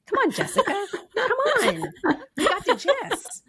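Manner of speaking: laughing plea to the guest; laughing tease. Real, not acted. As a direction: tease her while cracking up.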